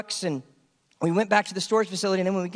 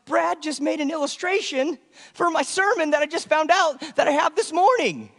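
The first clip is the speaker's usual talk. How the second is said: higher, half-laughing amazed rush; teary excitement; 9 semitones higher than usual. Retold or imitated. Pastor retells his teary realization in the garage: high, emotional rush. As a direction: gush it as if near happy tears. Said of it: "I'm kinda crying a little bit".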